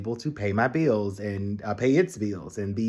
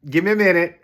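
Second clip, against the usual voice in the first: second take higher and louder, pleading for time; half-laughing overwhelmed plea; flustered stalling; 7 semitones higher, 6 dB louder, faster than usual. Retold or imitated. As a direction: hold up a hand, just a minute. Overwhelmed reply to IT.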